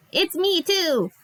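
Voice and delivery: high, drawn-out character voice; excited young character. Acted. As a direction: pitch it up and stretch the words. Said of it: "they're like".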